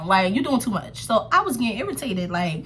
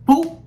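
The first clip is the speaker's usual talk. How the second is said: splash sound effect; 6 semitones higher, 6 dB louder than usual. Retold or imitated. Water thrown at her.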